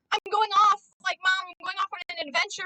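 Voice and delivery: imitating an excited child; eager kid. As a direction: play the kid begging to leave. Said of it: "my eleven-year-old would be like".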